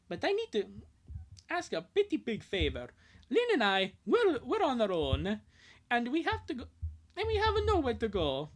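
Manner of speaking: Lincoln voice. Acted. Lincoln asks old friend Angus for a big favour, high and eager. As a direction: play the boy begging a favour.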